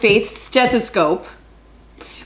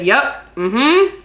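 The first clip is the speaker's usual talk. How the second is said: higher-pitched mock brush-off; pissy tone; 5 semitones higher than usual. Woman showing her own habitual phone tone, mock-pissy. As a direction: demonstrate a dismissive little acknowledgement, rising at the end. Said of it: "you know, tone".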